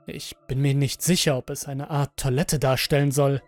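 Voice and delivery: ordinary delivery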